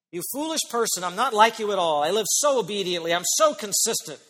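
hammed-up lofty telling-off; self-righteous scolding. Preacher mimicking a self-righteous believer rebuking someone, mock scolding. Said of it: "but that's how we sound".

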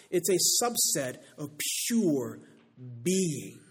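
loud, slow, weighty declamation; emphatic pulpit reading; preaching a quote. Acted. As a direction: proclaim each phrase with deliberate pauses.

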